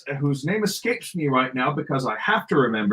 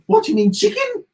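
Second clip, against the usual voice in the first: high-pitched, hammed-up Scouse; obnoxious Scouse accent; 4 dB louder than usual. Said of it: "obnoxious Scouse accent".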